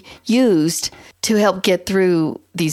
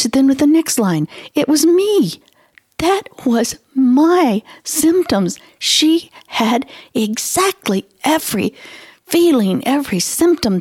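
emotional excitement; 6 semitones higher than usual. Retold or imitated. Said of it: "I was actually crying".

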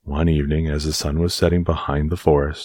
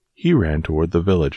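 ordinary delivery

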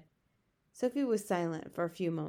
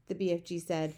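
usual speaking voice

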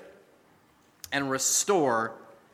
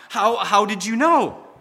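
louder, stumbling astonished ask; shocked question; 4 semitones higher, 6 dB louder than usual. Acted. Boy stunned that grandma already knew, shocked. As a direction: caught off guard, blurt it out. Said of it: "The boy was shocked".

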